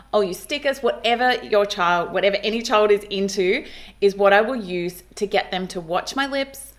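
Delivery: ordinary delivery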